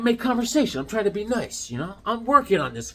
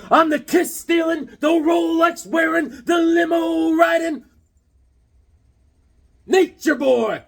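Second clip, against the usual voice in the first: higher, louder slow wrestling brag; Ric Flair; 10 semitones higher, 4 dB louder than usual. Acted. Vlogger quotes the wrestler's boast, loud and drawn out. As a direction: strut every word like a champ. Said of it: "Little Ric Flair on this one".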